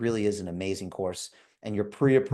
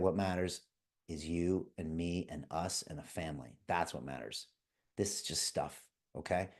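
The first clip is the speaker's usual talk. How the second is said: tender dad; 5 semitones lower, 10 dB quieter than usual. Retold or imitated. Calming scared sons after breakage.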